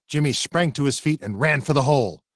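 plain reading voice

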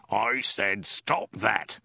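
slow, emphatic soldier's bellow; drawn-out, forceful command; bellowed order. A narrator's reading. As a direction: bellow it word by word. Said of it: "he bellowed".

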